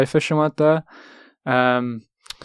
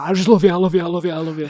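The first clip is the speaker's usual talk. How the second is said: gushing fan; 6 semitones higher, faster than usual. Retold or imitated. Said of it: "he proper, like, gets in his face".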